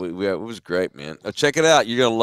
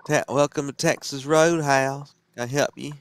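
put-on Georgia accent; 4 semitones higher than usual. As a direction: greet like a Georgia waiter, hamming up the accent. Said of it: "I need to get into character".

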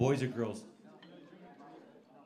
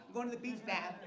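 mock daughter; 8 semitones higher, 8 dB quieter than usual. Daughter voiced.

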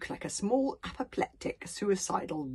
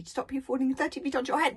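plain reading voice

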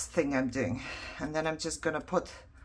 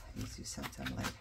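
much lower, fast and soft; low quick mutter; 9 dB quieter, faster than usual. Pretend. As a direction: mutter it quickly under your breath. Repeats the promise to the paper.

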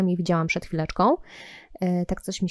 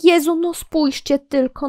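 usual speaking voice

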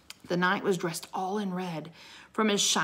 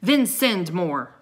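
loud, slow command; thundering; 8 dB louder, slower than usual. A narrator's reading. Knight orders the king to act, thundering. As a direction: thunder it out with weight on each word. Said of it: "thundered the Christmas knight".

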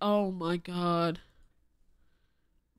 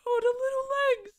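laughing squeal; much faster than usual. Real, not acted. Laughing reaction to video clip.

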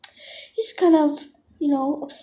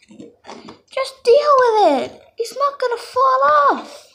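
high exasperated dismissal; 7 semitones higher than usual. Pretend. Parent dino brushing off the hurt leg, high impatient dismissal. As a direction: roll your eyes and wave the pain away. Dismissing injured dino's complaint.

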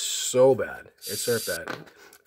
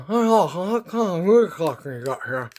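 higher, consonant-less muffled speech; mouth-guard garble; 5 semitones higher, 3 dB louder, slower than usual. Retold or imitated. Patient testing the dentist, garbled through a mouth guard. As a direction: garble the words as if the mouth is propped open. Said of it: "put that big mouth guard in".

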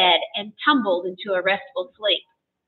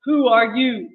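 lightly voiced harpooner's demand; understated challenge in a slight character voice. A narrator's reading. Harpooner questions the stranger in his bed, demanding. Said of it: "the harpooner demanded again".

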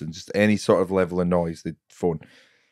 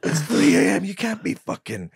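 exasperated comic impression, pitched high; Tom Stade; 9 semitones higher than usual. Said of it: "you doing an impression of him".

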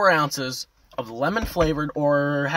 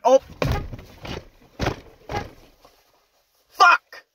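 frustrated outburst; 11 semitones higher than usual. Retold or imitated. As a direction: blurt it out, caught off guard by the price. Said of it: "I'm like".

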